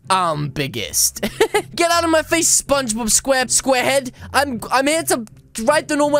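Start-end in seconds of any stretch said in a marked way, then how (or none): 0.1-1.2 low, slow, drawn-out boast; pompous low catchphrase; mock boast. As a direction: announce it slowly and deep, self-important.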